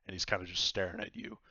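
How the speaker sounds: usual speaking voice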